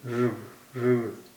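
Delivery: "ऋग" drawn out slowly as one joined r-g cluster; "ऋग" spoken slowly, no vowel between r and g; slow sound drill. Shown as a demonstration. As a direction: stretch "ऋग" so r runs straight into g. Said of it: "यानी इसका जो तलफ़ुज़ है ऋग".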